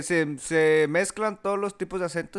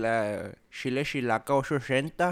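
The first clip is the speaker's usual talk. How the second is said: put-on Chihuahua accent; 3 semitones lower than usual. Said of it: "yo tenía el acento de la".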